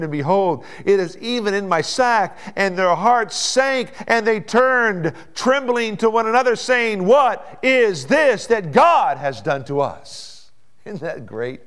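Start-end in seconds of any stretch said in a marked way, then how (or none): 0.0-10.5 loud, high, drawn-out scripture reading; full-force preaching. Acted. Preacher retelling the brothers' fearful discovery, full-force preaching. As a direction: ring out the verse with long stretched words.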